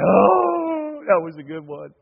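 high, swooning mock-teary sigh; hammed-up weepy kid after the movie. Pastor voicing his crying kids after the movie, high teary sigh.

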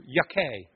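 yuckeh said with a throaty mock-Hebrew ending. Shown as a demonstration. Pastor jokes the congregation's word yuck into mock-Hebrew yuckeh. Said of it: "as we say in Hebrew".

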